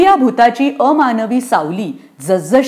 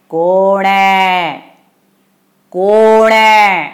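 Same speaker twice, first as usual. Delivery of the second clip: long drawn-out ghost call, swelling louder; ghostly challenge; 3 dB louder, much slower than usual. Acted. Ghost challenges the boy climbing the tree, drawn-out spooky call. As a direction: stretch each word out like a spook in a tree. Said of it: "कोणीतरी विचारलं".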